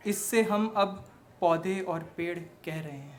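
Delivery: declaimed verse with emphatic line openings; stage poem recitation; poetry recital. Acted. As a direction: recite to an audience, punching the openings and easing off at line ends.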